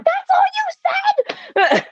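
high, drawn-out shriek of disbelief; mock-outraged mom. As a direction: screech it like an appalled mother, hammed up. Retells mom's outraged reaction to dad.

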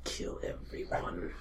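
breathy laughing threat, slow and high; mock villain whisper. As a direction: whisper a hammed-up murder vow. Scrubber snaps, vows to kill.

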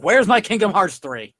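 loud, slow mock yell; mock shout. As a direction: yell it at the building. Shouting at building.

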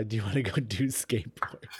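low, soft put-on hero voice; Spider-Man. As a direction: drop it deep and quiet, mock masked hero. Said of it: "a little deeper and".

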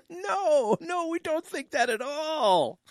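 chuckling through a mock denial; giggling no, no with a touch of irony; laughing denial. Real, not acted. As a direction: laugh it off, tongue in cheek. Laughs at his own joke.

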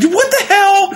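very high squeaky what the hell; a very high squeak of disbelief, retelling the police test; incredulous squeak. Retold or imitated. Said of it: "I'm like".

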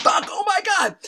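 high-pitched panicked exclamation; comic panic. Retold or imitated. Friends running from the smashed door, comic panic. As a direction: exclaim it while fleeing the damage.

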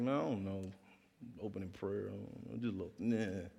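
embarrassed aside to friends; hushed mumble. Retold or imitated.

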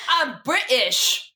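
slow put-on London accent. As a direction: drawl it like a Londoner. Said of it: "You sound like you're from London".

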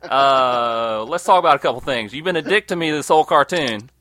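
loud, indignant negotiating; pretend defiant Rudolph. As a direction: Rudolph telling Santa off. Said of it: "I'd be like".

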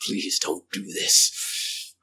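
low, slow, dark creature voice; dark, drawn-out menacing plea; darker tone. Acted. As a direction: drop it low and drag it out. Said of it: "but in a darker tone".

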